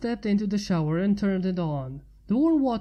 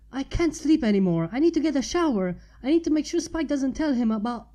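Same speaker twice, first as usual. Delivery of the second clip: hurried; 5 semitones higher, 5 dB louder, faster than usual. A narrator's reading. Suddenly remembers visitors, rushing.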